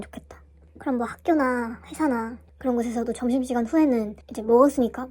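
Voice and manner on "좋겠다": soft unvoiced whisper. Real, not acted. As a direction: breathe the wish out quietly. Sudden whisper mid-explanation.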